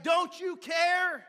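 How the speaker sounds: very slow, high and loud accusation; desperate shout. Acted. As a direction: stretch each word out in alarm. Disciples' panicked accusation.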